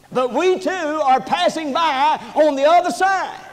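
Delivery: high, driving pulpit delivery; full-force preaching. Real, not acted. Preacher charging church with a greater crime, full-force preaching.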